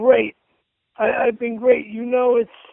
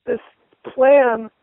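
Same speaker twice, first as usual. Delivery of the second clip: usual speaking voice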